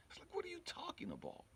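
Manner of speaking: ordinary talk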